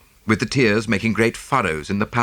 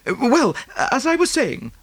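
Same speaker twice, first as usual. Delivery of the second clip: high, breathless socialite voice; gushing rich American lady. Acted. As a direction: pour it out like a fussy rich lady. Mrs Oppleson voiced.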